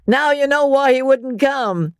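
high-pitched, jeering shout with ha-has; laughing shout. A narrator's reading. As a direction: shout the joke out to the whole table. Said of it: "He raised his voice to a shout".

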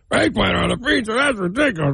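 high, incredulous mock scoff; mocking scoffer. Host mocking callers who laughed at him, high scoff. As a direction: sneer at the idea like a snob. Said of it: "people laughed at me".

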